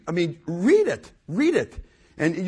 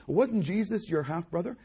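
ordinary talk, nothing marked

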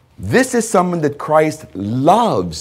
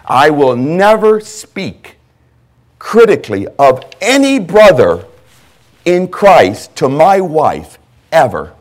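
louder, slow preaching of a personal vow; 3 semitones higher, 7 dB louder, slower than usual. Acted. Pastor tells congregation his commitment, preaching louder and slow. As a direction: drive home the promise slowly.

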